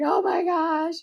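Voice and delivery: lightly voiced dad, high and delighted; a hint of a thrilled father; happy dad. Dad cheering the late comeback, high and delighted. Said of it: "he was like".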